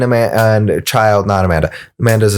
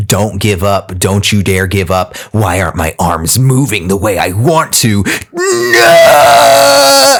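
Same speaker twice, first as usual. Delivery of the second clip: crying out in desperate struggle; hammed-up anime hero fighting to survive; overacted anime dub. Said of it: "this might sound like anime character intense".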